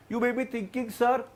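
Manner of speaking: ordinary talk, nothing marked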